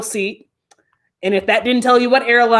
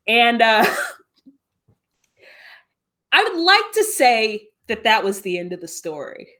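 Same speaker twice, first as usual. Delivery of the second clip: ordinary delivery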